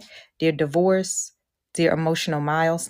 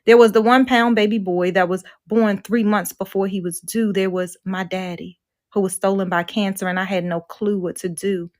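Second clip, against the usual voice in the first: louder, measured spoken-word recital; poem reading; 3 semitones higher, 5 dB louder than usual. Acted. Speaker reading her own poem to the retreat audience, spoken-word style. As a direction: perform the poem with weight and rise.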